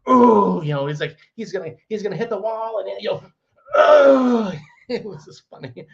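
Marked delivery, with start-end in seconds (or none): 0.1-0.6 loud, high imitated grunt; big grunting yell. Man ending his argument sentence, imitated grunting yell. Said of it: "that big grunting". 3.8-4.5 loud, high imitated grunt; big grunting yell. Man ending his argument sentence, imitated grunting yell. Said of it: "that big grunting".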